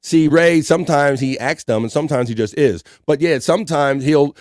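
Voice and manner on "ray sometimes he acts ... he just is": ordinary talk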